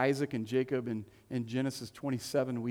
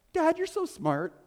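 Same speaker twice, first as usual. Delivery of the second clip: put-on much higher, louder little kid; a kid praising dad; child's voice; 5 dB louder than usual. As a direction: chirp it like an adoring child. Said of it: "child voice quoted".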